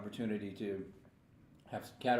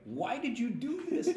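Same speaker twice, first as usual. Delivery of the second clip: high, slow pained whining; whiny celebrity; 4 dB louder than usual. Retold or imitated. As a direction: whine like a suffering celebrity. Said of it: "I've seen celebrities like".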